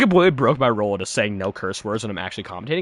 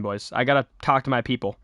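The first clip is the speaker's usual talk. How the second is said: usual speaking voice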